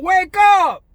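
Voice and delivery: loud rallying shout; urgent shout to the viewers. Real, not acted. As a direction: yell it at them to wake up. Yelling at viewers to wake.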